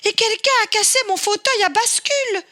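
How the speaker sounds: a tiny bear wailing over a broken chair; crying baby bear. Acted. Baby Bear to his parents, high, fast and tearful. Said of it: "en pleurant".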